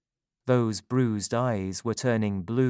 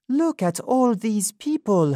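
higher, lighter woman's voice; Sophie's voice; 11 semitones higher, 4 dB louder than usual. A narrator's reading. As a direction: voice her higher and earnest.

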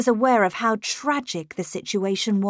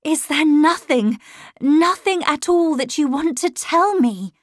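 higher and louder, pleading cry; 7 semitones higher, 5 dB louder than usual. A narrator's reading. Governess holds boy's shoulders, crying out. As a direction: beg him to speak, urgent. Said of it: "I cried".

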